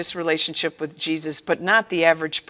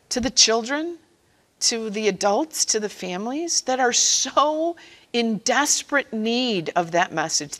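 ordinary talk

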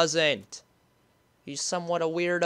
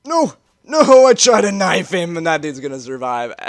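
much louder protest; can't believe it; frustrated outburst; 10 dB louder than usual. Real, not acted. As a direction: gamer complains loudly. Frustration at failed kill.

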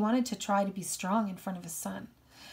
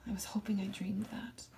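whispered, hushed with grief; shocked whisper; 9 dB quieter than usual. Acted. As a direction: breathe it out in a stunned whisper. Boy hears sister is dead.

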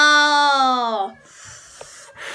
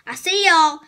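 building to shout; 7 semitones higher, 6 dB louder, slower than usual. Pretend. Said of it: "seeker building to a shout".

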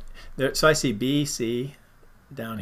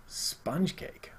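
whisper; 3 semitones higher, 11 dB quieter than usual. Pretend. Whispers the punchline.

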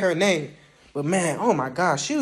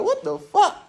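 shocked recruit; 12 semitones higher than usual. Retold or imitated. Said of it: "and you like".

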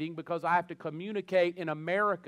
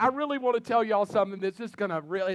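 higher, louder eager storyteller voice; mock preacher lead-in; 5 semitones higher, 6 dB louder, faster than usual. Preacher teasing a funny story to the crowd, mock eager. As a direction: start a joke with too much eagerness. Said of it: "it's like".

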